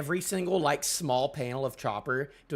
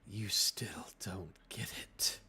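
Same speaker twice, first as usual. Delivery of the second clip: slow, soft whisper; 8 semitones lower, 9 dB quieter, slower than usual. Acted. Character whispering a cold rebuke. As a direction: breathe the line, no voice.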